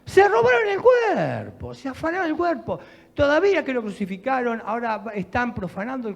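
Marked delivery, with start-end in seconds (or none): 0.0-2.8 alarmed Mary. Acted. Mary fears body stolen.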